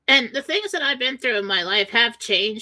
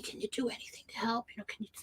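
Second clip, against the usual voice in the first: hushed whisper of people asking for help; whispered quote; 13 dB quieter, faster than usual. Retold or imitated. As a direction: whisper it like a secret question. People secretly asking her.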